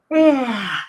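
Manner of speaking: loud, drawn-out demo yawn; fake yawn. Shown as a demonstration. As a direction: act out a yawn on purpose. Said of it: "a fake yawn like this".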